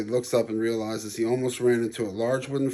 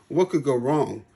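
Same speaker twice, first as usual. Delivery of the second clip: ordinary delivery, nothing marked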